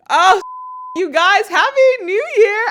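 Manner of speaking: high, loud, stretched happy New Year; excited shout. Real, not acted. Greeting listeners at the new year, an excited shout. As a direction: throw it out to the fans, thrilled.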